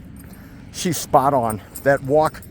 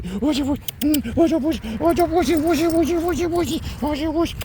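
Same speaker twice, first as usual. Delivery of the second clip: high sing-song teasing a dog; talking to a dog; 13 semitones higher than usual. Real, not acted.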